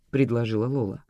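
plain reading voice

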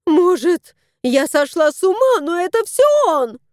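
woman blaming the cat to her son; distraught. A narrator's reading. Женщина взволнованно жалуется сыну, выше и громче.

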